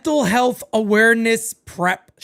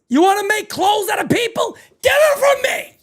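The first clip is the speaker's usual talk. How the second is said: yelling at TV; 9 semitones higher than usual. Real, not acted. Yelling at the TV show.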